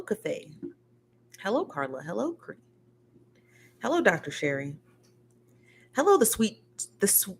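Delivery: normal talking voice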